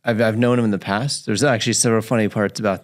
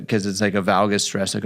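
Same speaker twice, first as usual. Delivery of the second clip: normal talking voice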